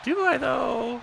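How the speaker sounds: hushed mock wondering; mock self-doubt. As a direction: stretch it out in quiet comic doubt. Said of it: "had to pause and be like".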